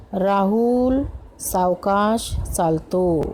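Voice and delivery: slowly. Shown as a demonstration. Example sentence about walking slowly.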